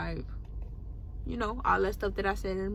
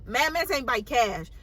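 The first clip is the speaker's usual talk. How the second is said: normal talking voice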